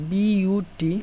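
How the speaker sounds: spelled out. Shown as a demonstration. Spells out the example word.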